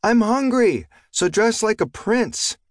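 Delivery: put-on high, slow child's call through a door; little girl yelling. A narrator's reading. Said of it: "she'd yelled".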